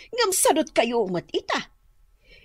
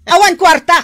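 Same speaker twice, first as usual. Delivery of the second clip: loud, higher angry refusal; angry shout; 5 semitones higher, 10 dB louder than usual. Acted. Wife shouting at her husband that there's no money. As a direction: shout it down at him.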